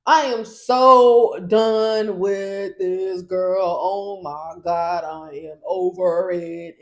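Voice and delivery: loud, slow oh my God; exasperated complaint. Retold or imitated. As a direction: drag it out, fed up. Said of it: "she was like".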